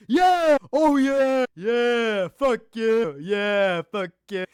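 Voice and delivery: loud, high yells of excitement; imitating his shouts. Fan mimics a fighter-YouTuber's ear-splitting catchphrase, imitated shouting. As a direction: belt out each yeah in mock hype. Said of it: "He'll be like".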